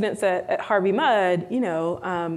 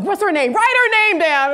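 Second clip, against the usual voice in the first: higher, louder indignant demand; 10 semitones higher, 6 dB louder than usual. Retold or imitated. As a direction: protective mentor demanding a name. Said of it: "she's like".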